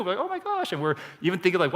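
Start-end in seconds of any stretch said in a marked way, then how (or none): 0.2-0.7 very high mock-excited squeal; pitched way up in happy disbelief; delighted amazement. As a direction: imitate a thrilled new friend. Retells delighted discovery with new friend.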